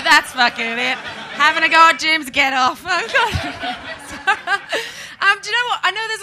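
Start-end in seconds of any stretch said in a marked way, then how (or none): 0.0-2.8 higher, loud heckle of outrage; mock heckler shout. Imagined audience heckler yelling at her, loud mock outrage. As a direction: bark it like an offended gym fan. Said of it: "I thought you were gonna go".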